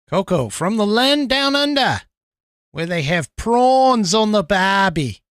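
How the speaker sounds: hammed-up Australian twang; bad Australian accent. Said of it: "Sorry, that's just horrible. Americans trying to accents".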